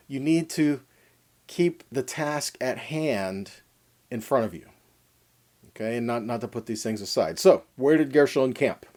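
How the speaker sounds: normal talking voice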